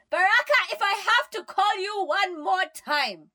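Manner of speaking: high, loud warning yell; furious mother shouting. A narrator's reading. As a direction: yell a final warning at your son. Mom yells threat from elsewhere.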